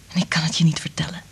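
hushed, quickening whisper. Acted. Liz describes reading minds to Frank, whispering. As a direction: whisper it quickly, as if it can't be told.

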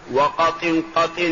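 "وقط قط" ending in -in after each ط; kasra with tanween. Shown as a demonstration. Teacher showing the third variant, kasra with tanween. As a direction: end each "قط" as "qatin", sounding the n. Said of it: "بالكسر مع التنوين".

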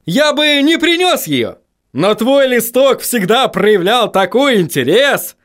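very high, slow character voice; stretched, high, eager delivery; gleeful vegetable enthusiast. A narrator's reading.

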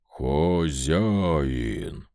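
low, slow stone golem drone; droning golem. Acted. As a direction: boom it out like a stone giant. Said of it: "прогудел голем".

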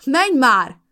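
loud high yell, a touch tearful; yelling through tears. Acted. Molly yells at her ex to go, loud and high. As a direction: scream him away. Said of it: "ordítottam a könnyeimen át".

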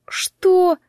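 high, startled outburst; stunned, pitch leaping up; shocked exclamation. A narrator's reading. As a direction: blurt it out aghast. Horrified at parrot repeating her words.

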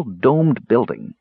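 usual speaking voice

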